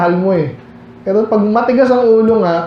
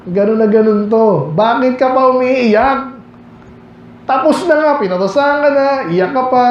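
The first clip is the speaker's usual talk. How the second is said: put-on higher, scolding a child who keeps crying; nag-aaway na magulang sa batang umiiyak; scolding parent; 6 semitones higher than usual.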